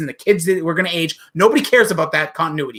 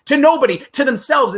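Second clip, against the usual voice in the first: loud indignant jab; 7 semitones higher, 3 dB louder than usual. Real, not acted. Angry punchline about the writers.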